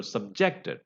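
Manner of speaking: subjected said sub-JEC-ted; stress on second syllable. Shown as a demonstration. Teacher repeats the verb, subjected stressed on JEC. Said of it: "stress is on the second syllable".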